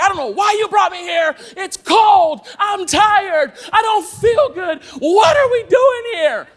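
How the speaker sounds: high, loud rant building to a drawn-out shout; imitated angry complaint. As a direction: act out an upset girlfriend losing it. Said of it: "She's angry".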